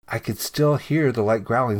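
usual speaking voice

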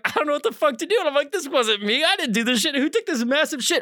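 high-pitched, frantic protest of innocence; flustered denial. Retold or imitated. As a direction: plead innocence in a rising fluster. Frantic denial to laughing coworker.

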